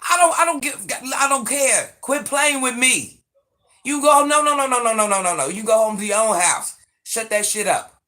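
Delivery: loud, high-pitched scolding; angry yell. Real, not acted. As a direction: yell angrily at your grown son in the back. Yelling at son gaming in back.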